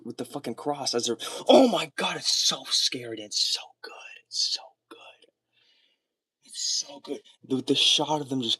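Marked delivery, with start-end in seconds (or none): 1.5-2.2 higher, louder burst of awe; overwhelmed by how good the scene is; excited gasp. Real, not acted. 2.2-7.2 whispered, breathless praise; hushed in awe of the scene; awed whisper. Real, not acted. Awestruck about the scene.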